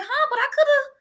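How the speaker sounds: high, flustered put-on voice; mock flustered self. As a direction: play yourself caught off guard and bluffing. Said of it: "I was like".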